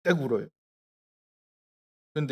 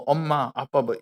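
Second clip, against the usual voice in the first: ordinary talk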